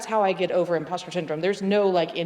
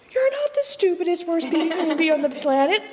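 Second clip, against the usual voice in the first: high, mock reassuring friend voice, much higher; 11 semitones higher, 3 dB louder than usual. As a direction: squeak it out like a chirpy reassuring friend. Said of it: "And they're like".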